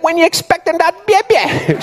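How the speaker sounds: put-on very high-pitched Jamaican accent; Jamaican security guard. Guard questions lady with basketball under shirt, high Jamaican accent. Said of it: "the Jamaican security guard".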